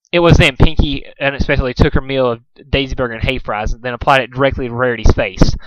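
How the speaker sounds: usual speaking voice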